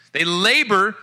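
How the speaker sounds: scripture reading. Acted. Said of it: "Psalm one twenty-seven".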